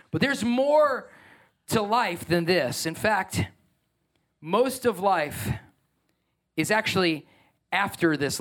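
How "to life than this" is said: ordinary talk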